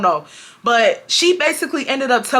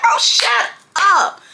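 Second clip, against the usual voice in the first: mock retort; 9 semitones higher, much slower than usual. Narrator's silent retort.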